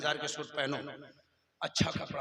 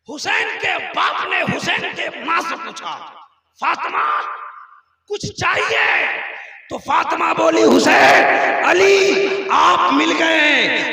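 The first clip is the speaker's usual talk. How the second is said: full-force shouted sermon, higher and much louder; impassioned preaching; 5 semitones higher, 19 dB louder than usual. Acted. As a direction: thunder out the retold dialogue, stretching the phrases. (जोशीली, बुलंद आवाज़ में तकरीर)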